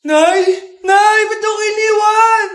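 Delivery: loud shouts for mom; calling out. Acted. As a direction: yell after a mom who left you. Left-behind child calls out. (malakas na sigaw na tinatawag si Nanay)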